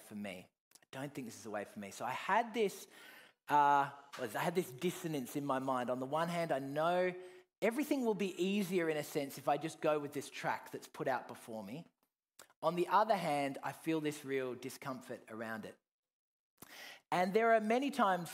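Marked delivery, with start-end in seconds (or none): none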